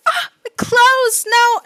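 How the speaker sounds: high, loud, slow wail over ruined clothes; wailing friend. Acted. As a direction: cry out in mock despair. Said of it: "her friends are like".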